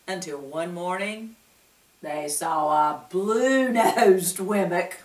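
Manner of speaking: ordinary delivery, nothing marked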